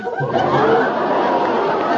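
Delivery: high breathy giggle. Real, not acted. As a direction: burst into a giggle. Giggle after answer.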